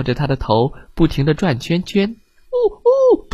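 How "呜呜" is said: high fish wail. Acted. Fish whirling round his head.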